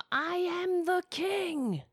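put-on high, very slow haughty boy king; mocking a petulant boy-king, stretched out slowly; King Joffrey. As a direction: sneer it out like a spoiled young king. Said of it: "our King Joffrey".

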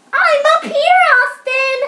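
high, loud call from above; story-reader's high holler. A narrator's reading. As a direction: call down to your brother, pitched right up. Boy calling down to his brother.

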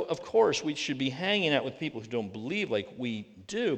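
usual speaking voice

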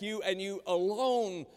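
"you and you alone" firmly punched; weight both "you"s and draw out "alone"; stress on YOU ALONE. Shown as a demonstration. Preacher says this is exclusive to you, emphatic "you alone". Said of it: "It is emphatic".

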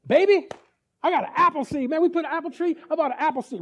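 proud, hyped-up bragging; husband calling out to his wife, thrilled; excited husband. Retold or imitated.

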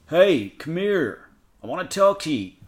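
high, drawn-out summons; calling out as God. A narrator's reading. God summons Adam and Eve from hiding, calling out. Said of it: "Adam and Eve heard God calling them".

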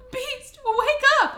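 high loud slow cry; hammed-up desperate call; mock frantic shout. Belle calling the unconscious beast, mock frantic shout.

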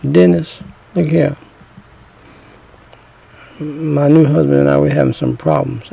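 hushed, low phone voice so he won't hear; speaking softly into the phone; talking low. Retold or imitated. Ex-wife calling her old man quietly, hushed and low. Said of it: "'cause she talking low".

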